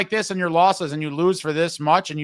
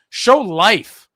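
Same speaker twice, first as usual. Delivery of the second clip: loud, higher burst of frustration; exasperated urging; 5 semitones higher, 9 dB louder than usual. Real, not acted. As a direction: punch it out as a fed-up demand. Urging the slumping player, exasperated.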